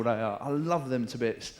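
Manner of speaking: normal talking voice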